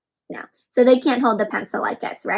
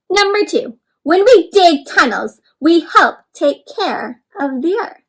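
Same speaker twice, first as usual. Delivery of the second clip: story reading; 6 semitones higher, 5 dB louder than usual. Acted. Reading the worm's diary aloud.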